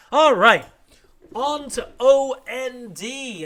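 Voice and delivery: big loud topic switch, slowed; loud announcement. Real, not acted. Host announcing the next segment, loud and slow. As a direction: announce the next section loudly.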